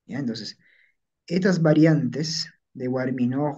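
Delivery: ordinary talk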